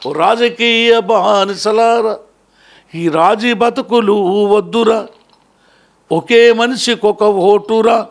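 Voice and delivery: impassioned public speech, swelling on the calls; stirring address to mothers and youth; fiery oration. Acted. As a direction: rouse the crowd like a folk orator.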